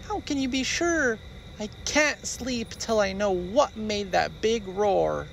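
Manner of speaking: high small pleading voice; a cub who can't sleep; worried baby bear. Acted.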